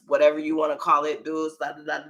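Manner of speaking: usual speaking voice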